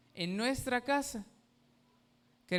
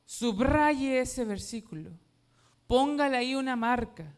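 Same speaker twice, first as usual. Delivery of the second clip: preacher's firm charge; firm preaching command; 4 semitones higher, 6 dB louder than usual. Real, not acted. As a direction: tell them firmly to mark it. Preacher instructing congregation.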